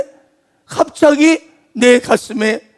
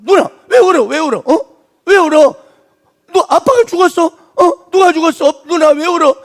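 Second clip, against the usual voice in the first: put-on higher, louder pleading child voice; worried little boy; 6 semitones higher, 4 dB louder than usual. Preacher voicing a young boy asking his crying sister, high and pleading. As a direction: tug at his sister with anxious questions. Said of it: "막 그러는 거야".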